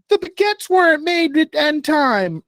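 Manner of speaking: high-pitched mock French accent; attempted French accent. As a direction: put on a silly, high French voice. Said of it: "French accent".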